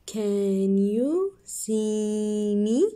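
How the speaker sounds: very slow, stretched question; slow reading. Shown as a demonstration. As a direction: drag each word out. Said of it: "So let's read".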